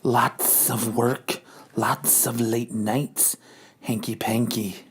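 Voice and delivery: low, soft, very slow innuendo; sultry Madam Hydra. Acted. Madam Hydra teases Hank about late nights. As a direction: drawl it low and suggestive.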